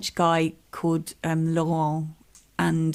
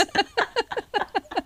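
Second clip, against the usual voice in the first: high laugh. Real, not acted. Laughs at the prison joke.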